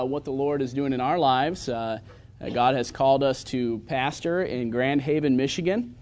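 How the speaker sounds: usual speaking voice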